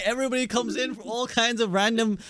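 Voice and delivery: usual speaking voice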